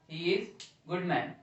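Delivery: very slow, each word apart; slow dictation. Shown as a demonstration. Teacher repeating the example sentence slowly for students. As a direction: read it out slowly for the class. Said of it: "teacher repeating sentence slowly".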